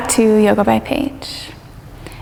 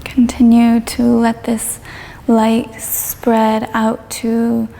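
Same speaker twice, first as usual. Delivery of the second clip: guided meditation. Real, not acted. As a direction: ease them gently through it, drawing out the phrases.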